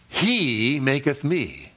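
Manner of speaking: slow, with "He" stretched long; stress on "He" and "me". Shown as a demonstration. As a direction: lean on the pronouns "He" and "me". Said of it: "Ron emphasized the personal pronouns".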